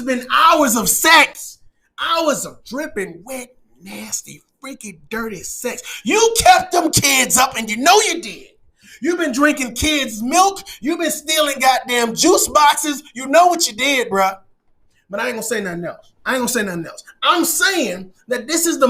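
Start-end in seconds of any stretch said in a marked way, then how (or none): 2.0-5.8 mock sultry. Mock-sultry aside about sex. 15.1-17.0 low, quick and soft; hushed mock discretion; backing-off aside.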